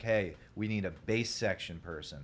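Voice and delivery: ordinary delivery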